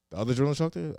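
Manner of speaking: improper casual answer. Retold or imitated. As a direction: answer casually, pitch lifted, as if unsure. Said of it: "Not like that, of course".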